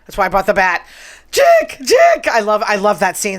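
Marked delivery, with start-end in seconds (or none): 1.3-2.3 very high, squeaky mimic call; mock squeal. Host mimics the stairs scene, squeaky high call. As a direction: squeak it out like a frightened Wendy.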